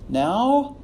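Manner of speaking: "Now" lifted high as a signal; quick transitional cue. Shown as a demonstration. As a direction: flag the next point with a high-pitched "Now". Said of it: "just give a quick little".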